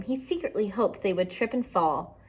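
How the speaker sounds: usual speaking voice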